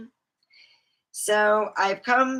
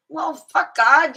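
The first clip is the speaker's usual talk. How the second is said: higher, louder, slow fuck God; mock defiance; 8 semitones higher, 7 dB louder, slower than usual. Pissed at God but not raging, slow and loud. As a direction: spit it out slow and high. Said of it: "Not raging mad".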